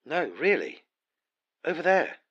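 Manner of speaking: high loud urgent pointing; excited calling out. Acted. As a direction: call out, eager and urgent. Pointing out a distant light.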